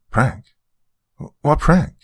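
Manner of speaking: high-pitched, startled questions; hasty blurt. A narrator's reading. Grandmother covering for a lie, blurting hastily. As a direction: blurt it out a bit too eagerly. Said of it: "blurted a little too hastily".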